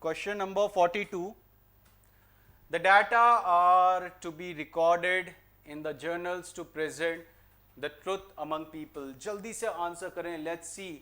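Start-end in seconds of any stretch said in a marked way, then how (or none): 0.0-9.1 very slow, careful dictation; slow question read. Shown as a demonstration. Teacher to class, reading question forty-two slowly. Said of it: "reads next question".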